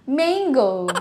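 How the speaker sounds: put-on playful Filipino accent, mango with an E vowel; Filipino mispronunciation. Teacher jokingly imitating Filipinos, Filipino mispronunciation. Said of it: "Ang mga Pilipino talaga gusto nilang".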